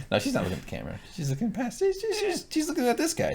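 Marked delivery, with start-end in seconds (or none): 1.1-3.3 high, squeaky protest over a friend's joke; squeaky high insistence. Real, not acted. Insisting on where she looks.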